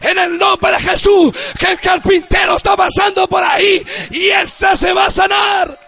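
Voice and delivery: loud, ringing pulpit declaration; fervent preacher calling out healing; preaching at full force. Acted. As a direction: proclaim it to the whole church. Leads church in declaring prayer.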